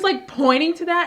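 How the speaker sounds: ordinary delivery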